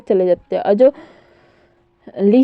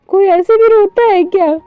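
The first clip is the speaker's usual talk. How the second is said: higher, louder shocked exclaim; shocked disbelief; 8 semitones higher, 5 dB louder than usual. Retold or imitated. Onlookers stunned by wild crying, high shocked exclaim. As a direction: gasp it out in disbelief.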